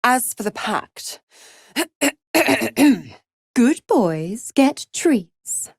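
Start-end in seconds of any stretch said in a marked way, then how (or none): 2.3-3.0 loud, deliberate ahem; pointed throat clearing. Acted. Pointed throat-clearing between lines.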